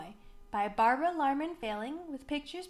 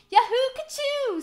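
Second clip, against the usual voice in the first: very high, loud cheer; cowboy whoop; 13 semitones higher, 10 dB louder than usual. A narrator's reading. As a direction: whoop with glee.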